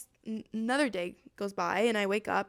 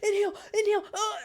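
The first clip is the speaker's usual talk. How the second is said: high, gasping re-enacted struggle; choking for breath; 9 semitones higher, 3 dB louder, faster than usual. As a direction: act out straining to get air in. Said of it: "And I just, I was coughing".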